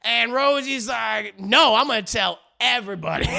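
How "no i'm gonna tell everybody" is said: mock defiant holler; hammed-up, loud threat to blab; loud defiance. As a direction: belt out a retold refusal. Retold defiant refusal in quarrel.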